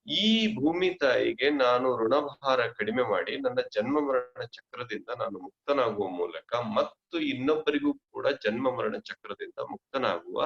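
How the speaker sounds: ordinary delivery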